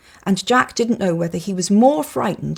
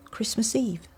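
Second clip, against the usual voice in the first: whisper; 5 semitones higher, 6 dB quieter than usual. Acted. Jack answers in hushed awe.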